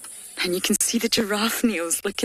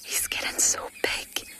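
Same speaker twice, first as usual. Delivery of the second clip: whispered and much softer, not to disturb the cats; hushed whisper; 4 dB quieter than usual. Real, not acted. Guide admiring the leopards in morning light, whispered. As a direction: breathe it out in a real whisper.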